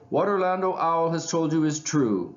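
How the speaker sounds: high, projected declaration; mildly projected. A narrator's reading. Crow calling from the back of the room, projecting. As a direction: carry it to the front of the room. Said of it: "said a loud voice from the back".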